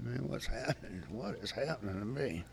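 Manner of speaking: a man shaking and scared asks himself softly; frightened hush. Retold or imitated. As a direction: ask it quietly, lost and afraid. Retold panic while shaking.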